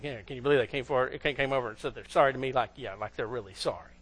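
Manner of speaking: low, quick, hushed aside; behind-the-back gossip. Acted. Someone talks about the apologizer behind their back, disbelieving. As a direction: confide your disbelief under your breath. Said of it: "Turn around and talk about".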